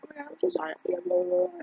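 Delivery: sarcastic falsetto sorry, trailing into blah blah; mock apology. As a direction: imitate yourself gushing an apology way up high. Retold awkward apology to guy.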